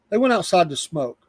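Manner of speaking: usual speaking voice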